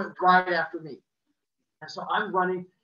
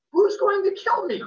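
put-on much higher, scared child voice; worried squeak; 13 semitones higher, 8 dB louder than usual. Scared child to neighbour, high worried question. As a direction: ask it like a frightened kid.

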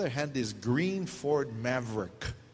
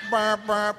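louder mock horn honk, burp burp; car horn imitation; 5 semitones higher, 4 dB louder than usual. Mom honks driving away, laughing mock horn sound. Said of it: "she would blow the horn".

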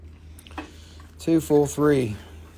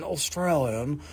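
slower, softer proper English "Australian"; proper English accent; 4 semitones lower, 7 dB quieter, slower than usual. Shown as a demonstration. Seller contrasting English with Australian, proper English accent. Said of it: "the English are very kind and proper".

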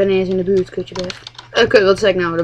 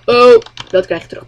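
ordinary talk, nothing marked

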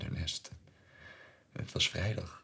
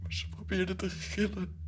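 high, loud, overwrought father losing his grip; frantic high pitch; 13 semitones higher, 9 dB louder than usual. Acted. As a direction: voice straining upward in mad tenderness. Overwrought climax. (hoog, luid en overspannen)

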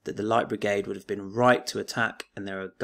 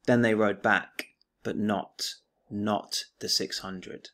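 poem recital; 6 dB louder than usual. Acted. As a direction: recite it like a stirring war poem. Teacher recites the poem aloud.

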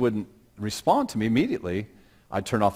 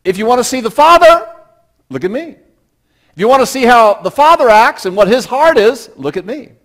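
higher, louder proclamation; Jesus, preached loud; 13 semitones higher, 14 dB louder than usual. Pretend. Jesus speaking to Philip, preached loud and high. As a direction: call it out boldly to the crowd. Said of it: "So Jesus said".

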